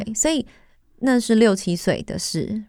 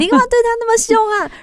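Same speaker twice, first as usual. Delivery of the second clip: high, loud, fast telling-off; indignant scolding; 6 dB louder, faster than usual. Retold or imitated. Friend's mother phoning her mom in Taiwan, loud indignant scolding. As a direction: tear into her for being so cruel.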